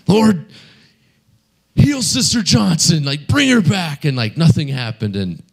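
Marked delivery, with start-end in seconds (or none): 0.1-4.0 high, pleading command to heal; preacher begging God at the deathbed; fervent prayer again. Retold or imitated. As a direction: call on the Lord, pitched high. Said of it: "And he's all".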